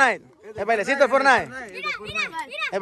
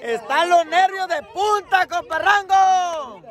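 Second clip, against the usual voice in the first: agudo, lento y alargado; pregón de comentarista; 9 semitones higher, 4 dB louder, much slower than usual. Real, not acted. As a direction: estirar las palabras como narrador de fútbol. Anima durante el partido. (comentario cantadito en tono alto)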